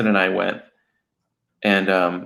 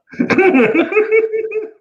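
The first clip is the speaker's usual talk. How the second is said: big burst of laughter, much higher; loud high laugh; 5 dB louder than usual. Real, not acted. Cracking up at the Clack Hole joke. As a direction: crack up loudly.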